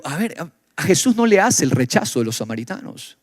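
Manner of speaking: ordinary talk, nothing marked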